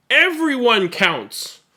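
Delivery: triumphant slow shout. Acted. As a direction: announce it to everyone. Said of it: "the number shouted".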